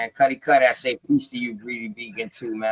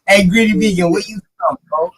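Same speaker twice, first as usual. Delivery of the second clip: loud, high greeting shout; calling out; 7 semitones higher, 5 dB louder, faster than usual. Real, not acted. Host calling out to guest Greedy Vegan, loud and high. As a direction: hail him across the room, pitched up and loud.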